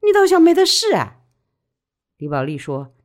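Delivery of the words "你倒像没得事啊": high, loud reproach; indignant scolding, pitched high; accusing. A narrator's reading. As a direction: confront her sharply, voice raised. Friend confronts widow in quarrel.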